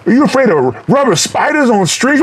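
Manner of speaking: high-pitched mock Reggie taunt; Reggie the Reckless quote. Fan quoting Reggie the Reckless's taunt, high-pitched and mocking. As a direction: fan acting out Reggie's rubber spider line, pitched high. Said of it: "Reggie the Reckless is fucking hilarious".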